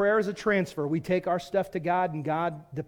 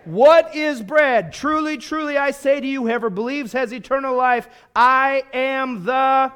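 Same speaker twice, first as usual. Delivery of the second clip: loud, raised preacher's proclamation; full-force preaching; 6 semitones higher, 8 dB louder than usual. Real, not acted. Preacher prompting congregation to finish Jesus's words, loud proclamation.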